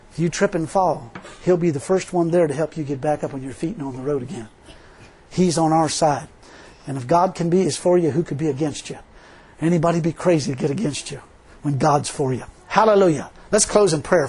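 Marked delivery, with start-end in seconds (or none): none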